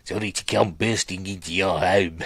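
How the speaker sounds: mimicked South African accent; playful imitation of a South African accent. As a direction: echo the film line in South African. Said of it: "I love the South African accent".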